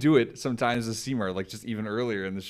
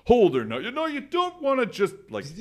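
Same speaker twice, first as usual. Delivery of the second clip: high, loud mock-aggressive dad; dad's fake aggressive voice; 7 dB louder than usual. Imitating his boisterous father, high and loud mock aggression. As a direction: bark it like a blustering dad. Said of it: "he talks like that too".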